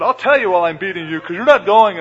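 Balaam furiously yelling at his donkey while beating it; angry shout. Retold or imitated. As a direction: bellow it at the stubborn donkey. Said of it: "But he just said".

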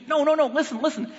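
high-pitched insistent pleading; urgent interruption. Retold or imitated. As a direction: imitate a wife cutting in to be heard. Wife interrupts argument, retold.

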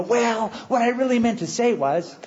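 high-pitched mock hedging; mock backpedalling. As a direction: imitate someone sheepishly walking it back. Mocks a backpedalling answer Jesus didn't give.

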